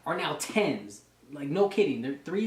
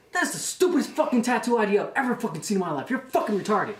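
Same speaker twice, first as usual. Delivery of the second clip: fast, raised, imitated tirade; berating drill instructor; 6 semitones higher, 3 dB louder, faster than usual. Drill instructor berating a recruit over his tattoo, exasperated.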